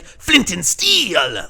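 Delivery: slow, drawn-out hammy delivery; mock movie catchphrase. Reviewer quoting the film's classic line, hammed-up and drawn out. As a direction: stretch the final word like a showy film line. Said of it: "and you got the classic".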